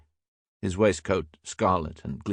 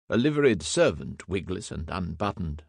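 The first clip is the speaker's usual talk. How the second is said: plain reading voice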